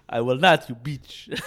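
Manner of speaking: defiant mock African accent; comic African-accented insult. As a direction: refuse in a put-on African accent. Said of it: "That's a good accent".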